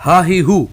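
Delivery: ہا ہی ہو said short, no stretching; short vowels. Shown as a demonstration. Teacher shows students the quick ہا ہی ہو. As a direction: clip ہا ہی ہو quickly. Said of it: "کھینچنا نہیں ہے بالکل بھی".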